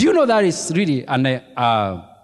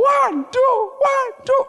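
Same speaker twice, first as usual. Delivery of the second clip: high shouted one-two count; drill count shout; slower than usual. Retold or imitated. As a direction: call the step count at full voice. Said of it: "you are supposed to be shouting".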